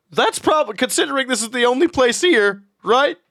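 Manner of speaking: puzzled character voice; Eugene voice. Acted. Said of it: "Eugene looks up and goes".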